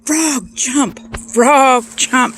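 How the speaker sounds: high, loud repeated holler; play-acted yelling, each word punched; mock screaming. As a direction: scream the order over and over at a frog. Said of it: "he's screaming at that frog".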